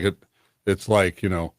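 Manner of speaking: ordinary talk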